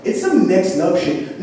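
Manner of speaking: ordinary talk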